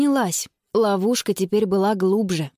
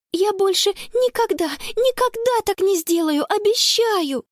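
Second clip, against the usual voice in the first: high, pleading vow; earnest promise; 10 semitones higher than usual. A narrator's reading. Sister hugging her brother, promising fervently. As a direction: swear it fervently, slowing on the last word.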